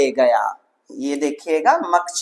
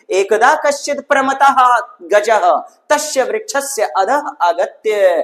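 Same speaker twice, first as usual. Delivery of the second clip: higher, chanted reading of the verse; teacher reading the Sanskrit passage aloud for students, recitation style; 5 semitones higher than usual. Acted. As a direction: recite the Sanskrit line like scripture.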